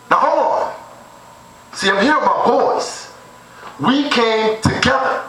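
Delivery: high, slow, mock-dainty refusal; prissy refusal. The comic turning down a girl at the club, high mock-dainty. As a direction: hold the hand up and sound like one of the girls. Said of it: "And I was like".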